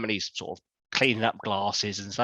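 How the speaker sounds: usual speaking voice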